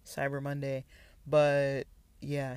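ordinary talk, nothing marked

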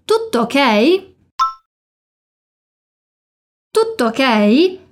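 "Tutto" held on the double T before "ok", slow; slow "Tutto ok?" with a clear stop on the double T; pause in tutto. Shown as a demonstration. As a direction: stretch the pause in "Tutto" to land both T's. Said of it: "Faz aquela pausa no tutto".

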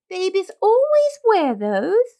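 high, slow and prim; primly. A narrator's reading. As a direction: lay down the rule like a prissy nurse. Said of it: "said the nurse primly".

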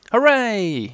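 storybook shout of delight; cheering shout. A narrator's reading. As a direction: call out a hooray from below. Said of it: "you shouted".

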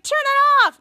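high, loud acted plea; disgusted. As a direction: cry out in mock revulsion. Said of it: "they were disgusted".

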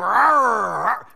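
loud playful growling roar; mock lion roar. Preacher mimicking a tough lion, loud playful roar.

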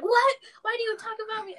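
loud, high retort; mock indignant mom. Mom to daughter overheard complaining, mock indignant. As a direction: imitate an affronted mother.

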